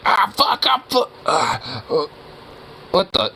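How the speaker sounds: high, dazed mock groaning; imitating a hurt player. Player mimics a teammate coming back hurt, high and dazed. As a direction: act out waking up confused and hurting. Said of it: "come back and be like".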